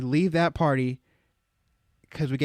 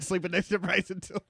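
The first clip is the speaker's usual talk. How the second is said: higher, softer teasing echo; mocking imitation; 6 semitones higher, 5 dB quieter than usual. Storyteller objecting to co-host's groupie insinuation, mocking imitation. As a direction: parrot the insinuation in a high voice. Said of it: "Don't say it like that".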